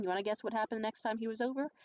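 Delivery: ordinary talk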